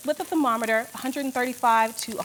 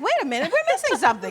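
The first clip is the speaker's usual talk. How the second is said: high, rushed, louder mock panic; mock alarm; 7 semitones higher, 4 dB louder, faster than usual. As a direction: blurt it out fast, playfully alarmed. Mock alarm retelling own forgotten step.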